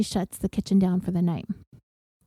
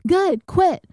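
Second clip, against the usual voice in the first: high, louder dismissive snap; mock yell; 8 semitones higher, 4 dB louder than usual. Amy dismissing quitting Katie, retold yell. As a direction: fling it back at her. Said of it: "Amy's still yelling".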